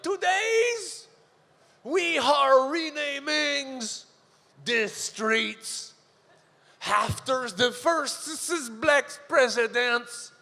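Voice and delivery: high, slow, grand French-Canadian mayor announcing; hammed-up mayor at a ribbon-cutting, dragged out; pompous mayor. Mayor of Montreal announces the renaming, hammed-up and slow.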